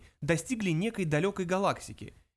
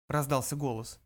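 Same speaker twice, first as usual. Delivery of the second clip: usual speaking voice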